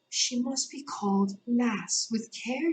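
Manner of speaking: slow, high, gentle voice; gentle distant voice. A narrator's reading. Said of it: "a very gentle voice in the distance".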